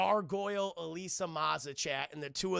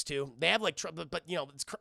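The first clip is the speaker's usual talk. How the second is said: ordinary talk, nothing marked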